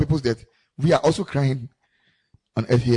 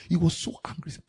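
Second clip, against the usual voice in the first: hushed whisper about his anger; 7 dB quieter than usual. Real, not acted. Whispering to the congregation about the elder's anger.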